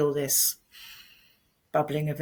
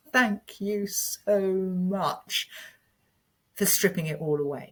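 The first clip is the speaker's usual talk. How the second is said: slow heartfelt thanks; 3 semitones higher, slower than usual. Retold or imitated. Grateful thanks to the breakdown.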